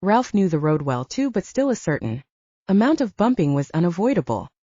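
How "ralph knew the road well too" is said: plain reading voice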